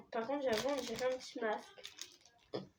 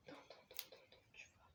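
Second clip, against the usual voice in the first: breathy hushed whisper, much softer; 23 dB quieter than usual. Real, not acted. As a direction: drop to a secret whisper. Suddenly whispers. (chuchoté tout bas)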